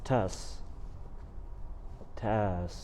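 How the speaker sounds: low-key, hushed leading-man impression; soft, sparse Clooney impression; George Clooney. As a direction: murmur it softly like a movie star. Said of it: "Do you wanna do George Clooney".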